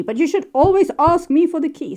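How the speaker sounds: high, loud, stubborn troll who insists; insistent troll. Retold or imitated. Troll telling the other trolls off, high and insistent. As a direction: raise the pitch and demand it like a fussy troll.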